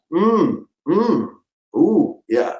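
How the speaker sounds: soft commercial yum hums; mock tasting hum. Showing the soup commercial take, soft tasting hums. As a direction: hum like you love the soup. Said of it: "thirty, forty, fifty times".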